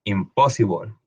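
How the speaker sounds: Impossible ending in a dark -bol; English word demo. Shown as a demonstration. Teacher models the word for students. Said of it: "la L E se va a convertir en ol".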